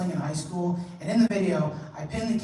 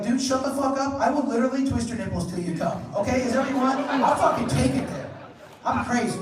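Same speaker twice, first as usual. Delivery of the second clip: mock crazed threat; 6 semitones higher than usual. Adult threatening friend.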